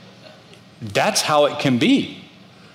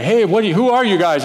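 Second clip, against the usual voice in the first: startled challenge; 9 semitones higher, 4 dB louder, faster than usual. Retold or imitated. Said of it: "they're like".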